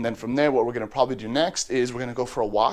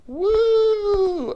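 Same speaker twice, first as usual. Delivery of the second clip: very high, loud whoop; excited whoop; 6 dB louder than usual. Real, not acted. Owner hyping the parrot for breakfast, loud high whoop. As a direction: whoop to hype the bird.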